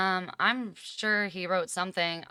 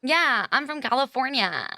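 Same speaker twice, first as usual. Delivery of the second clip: valley voice; 8 semitones higher, 6 dB louder than usual. Pretend. Playful California reply, high and loud.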